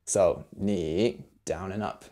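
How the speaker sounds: 你 dipping down and then up, softly; third tone demo. Shown as a demonstration. Teacher showing the third tone of 你. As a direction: trace the tone of 你 down and up. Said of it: "add a third tone".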